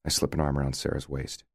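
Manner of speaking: ordinary delivery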